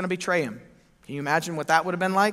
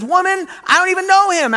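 much higher, loud defensive outburst; panicked denial; 13 semitones higher, 10 dB louder than usual. Acted. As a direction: blurt it out in a panic. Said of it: "He says".

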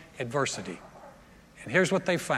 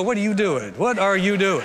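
mock puzzled God; 5 dB louder than usual. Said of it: "He might look at you and go".

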